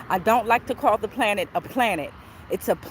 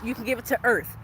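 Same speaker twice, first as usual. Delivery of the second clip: normal talking voice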